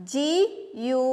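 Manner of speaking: ordinary talk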